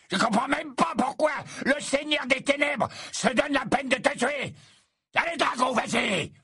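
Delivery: high, mocking rant with laughs; shrill scornful taunt. A narrator's reading. Death Eater scorning Dumbledore, shrill mocking rant. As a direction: sneer at his empty talk.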